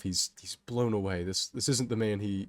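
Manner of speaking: ordinary delivery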